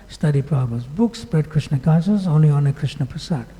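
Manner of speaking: ordinary delivery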